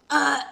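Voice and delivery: a sudden high disgusted uh. Retold or imitated. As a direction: gag at the thought with a high uh. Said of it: "And I'm like".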